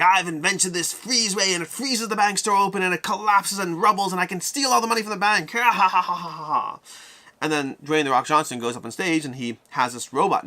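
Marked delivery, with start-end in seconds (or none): 0.0-6.8 high, theatrical villain gloating into cackle; hammed-up evil villain. Rival villain pitching a freeze ray at the evil contest, mock gloating. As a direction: build your scheme up to a mock evil laugh. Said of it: "Another guy's like".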